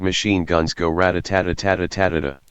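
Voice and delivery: normal talking voice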